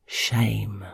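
soft unvoiced whisper. A narrator's reading. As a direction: breathe the word out. Whispered word in story.

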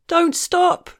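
high, loud cry of anguished horror. Acted. Man pleading with the teasing narrator, anguished horror. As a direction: cry it out in horrified protest. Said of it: "with a kind of anguished horror".

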